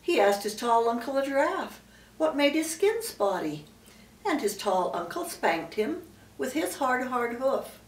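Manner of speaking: plain reading voice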